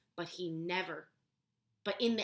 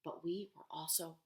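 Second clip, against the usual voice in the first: whispered; 3 semitones lower, 8 dB quieter than usual. A narrator's reading. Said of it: "her abuela whispered".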